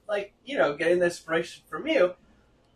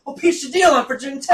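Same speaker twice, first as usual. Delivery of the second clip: loud, high burst of encouragement; mock good-luck cheer; 9 semitones higher, 10 dB louder than usual. Student wishing a teammate luck before game, loud mock cheer.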